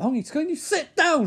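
high, loud exasperated yell; hammed-up furious outburst; pissed off. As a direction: shout it as an acted telling-off. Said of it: "She's really pissed off".

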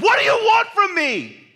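very high, loud and slow shout. Retold or imitated. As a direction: scream it at God from the driver's seat. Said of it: "and also I just shout".

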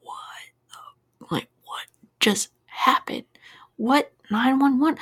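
hushed, whispered mock disbelief; imagine a dumbfounded dispatcher, barely getting words out; stunned operator. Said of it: "the operator's probably like".

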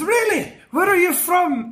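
high eager Scots voice; Willie eager. Retold or imitated. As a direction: ask it excited as Willie. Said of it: "he goes".

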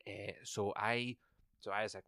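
usual speaking voice

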